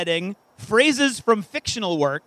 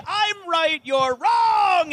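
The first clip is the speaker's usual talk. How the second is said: gloating; 9 semitones higher, much slower than usual. Acted. Gloating at the contestants.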